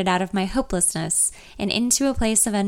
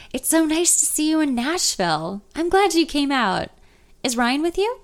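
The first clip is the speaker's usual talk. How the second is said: lightly voiced cheery grandfather, higher; friendly old man; 7 semitones higher than usual. Ben welcoming her to Nashville, light cheery grandfatherly voice.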